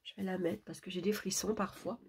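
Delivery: soft, strained sore throat voice. Real, not acted. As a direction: talk gently through a sore throat. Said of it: "je parlerai pas trop fort parce que j'ai une angine".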